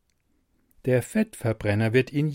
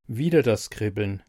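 plain reading voice

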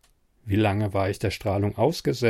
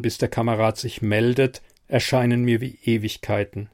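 ordinary delivery, nothing marked

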